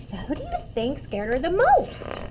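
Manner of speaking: ordinary delivery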